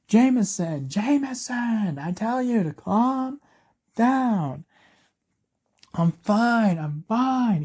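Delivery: high, drawn-out reassuring yell; yelling, almost smiling. A narrator's reading. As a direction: call out over the crowd to calm them. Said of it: "he's yelling. He's almost smiling".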